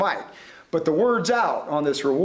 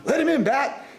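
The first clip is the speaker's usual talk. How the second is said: yell; 7 semitones higher than usual. A narrator's reading. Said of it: "I yelled at the feller".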